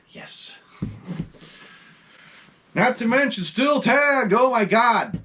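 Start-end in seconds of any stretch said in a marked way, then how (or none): 2.7-5.2 excited. Real, not acted. Thrilled by rare tagged mask.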